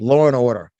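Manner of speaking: Law and order with the New York lawr and awdah; New York accent. Shown as a demonstration. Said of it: "That's my New York accent".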